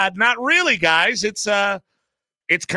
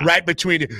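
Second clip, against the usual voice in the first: ordinary delivery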